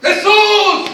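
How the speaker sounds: high, loud drawn-out call; acted shouting. Blind man stumbling through the crowd after Jesus, shouting. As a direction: cry out blindly for help. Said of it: "¿Cómo le gritaban los ciegos?".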